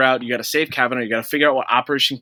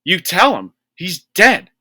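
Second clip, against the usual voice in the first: higher, louder exasperated retort; mock exasperation; 6 semitones higher, 4 dB louder than usual. The player scolds a grieving game character, higher mock exasperation. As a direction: talk back to the character, fed up.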